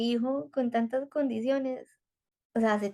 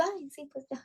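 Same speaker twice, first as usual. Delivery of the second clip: dismissive; 5 semitones higher, 10 dB quieter than usual. Retold or imitated. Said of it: "Y yo".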